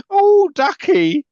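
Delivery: very high, slow, camp drawl; a Carry On film camp line. Retold or imitated. Mocking the advisor as a Carry On character, slow and camp. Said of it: "wander in from a Carry On film".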